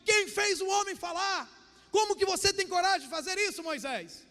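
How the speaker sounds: high-pitched, booming rebuke; furious God. Acted. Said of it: "Deus fica furioso com Moisés".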